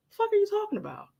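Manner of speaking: high, baffled disbelief; can't believe what she's hearing; confused outburst. Retold or imitated. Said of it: "I was so confused".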